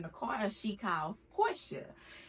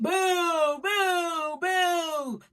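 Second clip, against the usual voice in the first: mock booing; 8 semitones higher, 5 dB louder, much slower than usual. Real, not acted. Teasing fake viewers.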